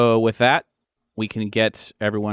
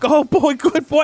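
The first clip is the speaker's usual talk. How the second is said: put-on much higher, louder delighted old-scientist cry; overjoyed Doc; 6 dB louder, slower than usual. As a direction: gush over the dog like Doc. GM voices overjoyed Doc greeting dog.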